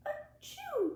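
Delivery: high playful achoo; mock sneeze. Librarian acts a sneeze for the children, playful. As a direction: pretend sneeze for the kids.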